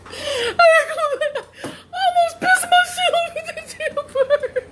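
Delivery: high, slow squeal through laughter; helpless laughing. Real, not acted. Woman confessing how scared she was, helpless laughing. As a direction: squeeze the words out while cracking up.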